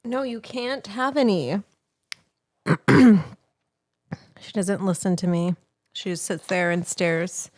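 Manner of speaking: ordinary talk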